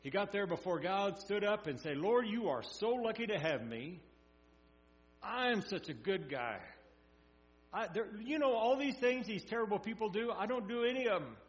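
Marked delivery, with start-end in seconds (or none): 2.0-11.4 louder, higher self-satisfied boasting prayer; smug Pharisee. Retold or imitated. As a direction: brag to God as a proud Pharisee. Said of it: "his prayer from my standpoint".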